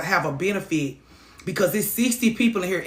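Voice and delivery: normal talking voice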